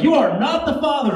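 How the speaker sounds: high, announcing the result; lightly mock talk-show host; dramatic paternity reveal; Maury Povich reveal. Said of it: "the doctor's like".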